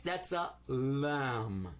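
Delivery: "lam" very slow and drawn out; Arabic lam. Shown as a demonstration. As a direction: introduce the letter "lam" to the class. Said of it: "In Arabic".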